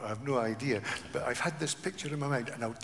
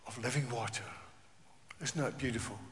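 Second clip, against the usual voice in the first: softened wonder; 4 semitones lower, 8 dB quieter, faster than usual. Real, not acted. Softened after climax.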